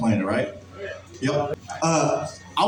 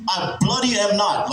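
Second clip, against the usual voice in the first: higher-pitched fake British; mock British accent; 4 semitones higher than usual. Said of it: "with the British accent".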